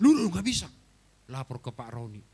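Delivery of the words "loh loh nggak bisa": high, loud alarmed "loh, loh"; startled outcry of a pastor; shocked protest. Retold or imitated. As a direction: object in sudden disbelief. Pak Novi shocked at injustice.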